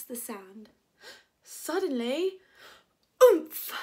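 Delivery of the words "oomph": sudden loud, high oomph of impact; loud bump grunt. Acted. Preston bumping into the girl, loud startled oomph. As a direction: grunt as if knocked into. Collision on the beach.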